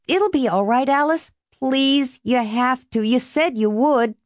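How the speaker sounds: high, insistent pleading; begging. A narrator's reading. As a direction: coax and plead with a reluctant date. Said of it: "Lester begged".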